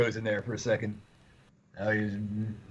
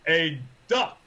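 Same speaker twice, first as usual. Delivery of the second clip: forceful, higher and louder announcement; King Arthur; 4 semitones higher, 5 dB louder than usual. Retold or imitated. Retelling the movie's witch bit, forceful kingly answer. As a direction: deliver it as a weighty pronouncement from a king. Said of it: "the forceful way he says that".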